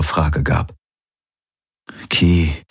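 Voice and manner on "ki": mildly troubled address; understated dejection; downcast. A narrator's reading. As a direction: say the name with a heavy heart. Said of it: "er klang bedrückt".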